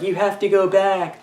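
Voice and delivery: a lightly voiced wooden child actor; bad acting. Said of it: "the kid's like".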